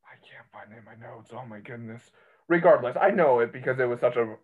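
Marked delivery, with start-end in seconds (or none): 0.0-2.0 whispered under breath to self. Real, not acted. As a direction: mutter it barely voiced, searching. Muttering while searching notes.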